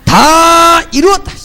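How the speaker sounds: slow, high, ringing proclamation; Jesus's last words, preached loud and slow; proclaiming. Acted. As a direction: declare it from the cross, drawn out. Quoting Jesus on the cross.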